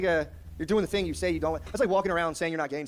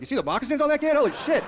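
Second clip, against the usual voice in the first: much higher, louder amazement; pilot awestruck; 11 semitones higher, 6 dB louder than usual. Retold or imitated. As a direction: gush like a pilot spotting the moccasins.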